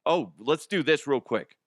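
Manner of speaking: ordinary talk, nothing marked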